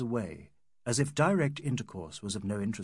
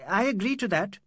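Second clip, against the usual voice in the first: flustered; 8 semitones higher, 4 dB louder than usual. A narrator's reading. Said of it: "hiding his face desperately".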